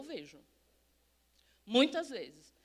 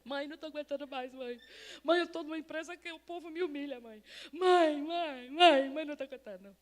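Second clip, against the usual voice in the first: imitated wailing plea to mother; lightly acted crying phone call, building on the repeats; mock tearful daughter. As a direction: plead like an overwhelmed daughter. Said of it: "me ligou chorando".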